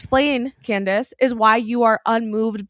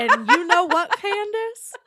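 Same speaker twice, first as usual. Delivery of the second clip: put-on Southern accent; 10 semitones higher than usual. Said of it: "his Southern accent is more prominent".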